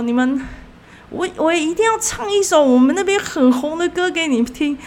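he can't wait to sing; excited announcement. Retold or imitated. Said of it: "突然很开心说".

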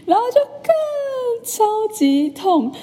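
very high, slow, drawn-out cry of pain; stretched pain exclamation. Real, not acted. Recalling the needle to her viewers, drawn-out pained exclamation. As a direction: stretch every word up high like it still hurts.

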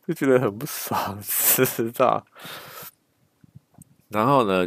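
ordinary talk

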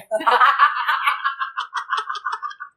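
拖长的高音笑声; 讲完笑点自己笑出来; long high laugh. Real, not acted. 讲完女儿的反击后大笑. (长长地笑)

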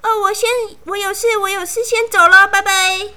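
high, fast nervous excuse; scared friend making excuses. A narrator's reading. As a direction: hurry off, flustered. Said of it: "她的朋友们看了就吓到了".

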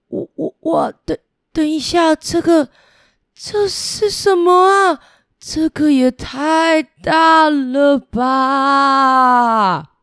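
river god stunned at the ocean's size; amazed river god. Acted. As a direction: gasp it out, overwhelmed by the sea. 河伯 first sees the sea.

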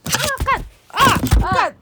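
sudden high startled yelp; startled curse. Real, not acted. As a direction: yelp a curse as the phone falls. Phone suddenly drops. (受惊地尖叫骂出声)

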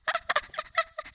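a high laugh out of breath; high breathy laugh. Real, not acted. Laughing remembering the absurd scene, high giggle.

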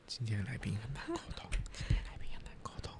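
hushed whisper, as if confiding to listeners; whispered mock aside. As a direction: whisper a teasing complaint about the guest. Mock aside about the guest. (假装偷偷抱怨的气声耳语)

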